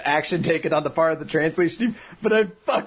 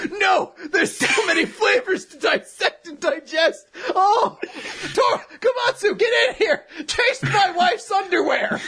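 high, slow, laughing hollering; ecstatic mock shouting; 11 semitones higher than usual. Groom calling Komatsu to taste, ecstatic shouting. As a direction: call a friend over in hammed-up rapture.

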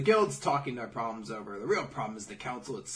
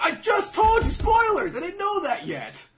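very high, loud comic yell; mock shout; 12 dB louder than usual. Mocking a character angry at spoilers, hammed-up shout. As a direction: scream it in hammed-up outrage. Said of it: "He's like".

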